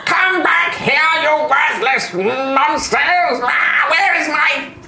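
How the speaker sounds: high furious yelling; angry shout. Acted. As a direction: scream after the fleeing gnomes. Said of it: "And the skull is like".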